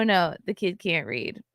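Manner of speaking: ordinary delivery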